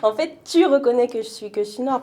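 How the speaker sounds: high, loud excited inner voice; playing her younger self, thrilled; mock delight. Joie ironique d'être vue noire. (joie exagérée, voix haute et forte)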